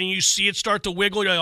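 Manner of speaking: normal talking voice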